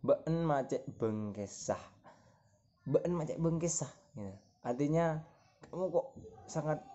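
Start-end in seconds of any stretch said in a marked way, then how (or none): 0.0-3.9 "been macek bengkesah" in native Madurese pronunciation; Madurese phrase. Shown as a demonstration. Student giving a rude Madurese example, native pronunciation. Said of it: "salah satu contohnya".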